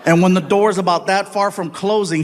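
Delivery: ordinary talk, nothing marked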